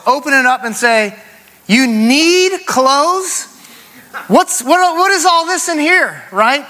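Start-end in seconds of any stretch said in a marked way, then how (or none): 1.7-6.2 high, slow incredulous dad; mock disbelief. Dad to daughters asking for money, mock disbelief. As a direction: act shocked at the full closet. Father retorting to daughters' request.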